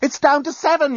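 very high, panicked yell; alarmed high-pitched cry. A narrator's reading. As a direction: yell it out as a frantic warning. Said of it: "yelled the journalist".

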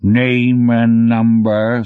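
slow, heavy voice of a mountain; rumbling mountain voice. Acted. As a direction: speak slowly, like a mountain talking. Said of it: "its voice about what you'd expect from a mountain".

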